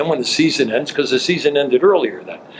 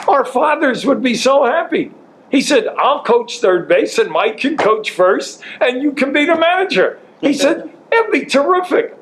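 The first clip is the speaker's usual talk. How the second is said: gleeful teasing; 13 semitones higher than usual. Retold or imitated. Friend teases about managing baseball.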